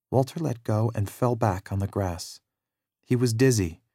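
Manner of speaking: usual speaking voice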